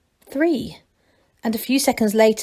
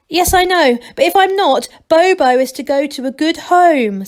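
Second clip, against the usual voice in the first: higher, louder worried call upward; anxious calling; 7 semitones higher, 7 dB louder than usual. A narrator's reading. Angelo dangling on the rope calling up about Bobo. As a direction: plead it up from below.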